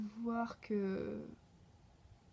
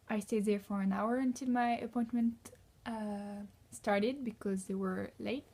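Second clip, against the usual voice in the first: French-accented English. Shown as a demonstration. French au pair telling viewers her day, slow French-accented English. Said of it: "je vais vous raconter ma journée d'aujourd'hui".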